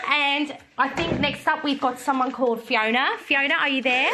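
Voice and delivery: amused Australian presenter; playful put-on Australian; Australian accent. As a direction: call up the customer in an Australian accent.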